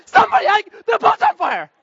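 high, loud frantic cries for help; freaked-out yelling. Retold or imitated. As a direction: act out running around in a panic. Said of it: "I freak out".

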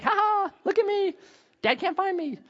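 mock giggly toddler taunt; giggling toddler. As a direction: tease like a hiding two-year-old.

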